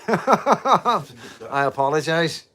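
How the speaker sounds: real laugh over a sorry; laughing apology. Real, not acted. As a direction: giggle the apology out. Laughs at a garbled line.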